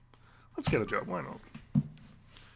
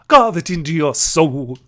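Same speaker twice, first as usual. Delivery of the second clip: much louder, slow, each word hammered; loud slow sparrow command; 10 dB louder than usual. Acted. Sparrow repeating her motto, loud and slow. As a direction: bellow the motto slowly.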